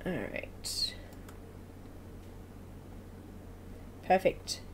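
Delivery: soft unvoiced mutter while busy; whispering to herself. Real, not acted. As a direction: whisper it under your breath.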